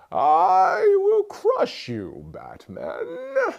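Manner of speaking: very slow, high, theatrical villain voice; Bane. Shown as a demonstration. Evil character threatening Batman, slow booming menace. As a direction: draw out every word as a menacing masked villain. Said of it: "I'm Bane".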